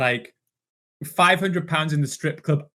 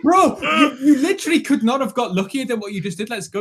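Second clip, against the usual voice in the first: disbelief; 8 semitones higher, 3 dB louder than usual. Retold or imitated. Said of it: "I'm sitting there like".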